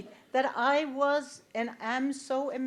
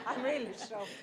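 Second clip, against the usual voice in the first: laughing; 8 dB quieter, much faster than usual. Real, not acted. Joking reaction to the secret.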